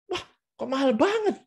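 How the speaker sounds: very high-pitched astonished exclamation; gasping disbelief at the price, pitch soaring; genuinely surprised intonation. Shown as a demonstration. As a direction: really sound shocked by how expensive it is. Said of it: "itu yang Anda harus lakukan".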